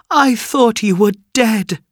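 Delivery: high-pitched, overwhelmed mother's exclamation; emotional cry. A narrator's reading. Mother greets son she thought dead, crying out high. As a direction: let relief burst out in a high voice. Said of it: "cried his mother".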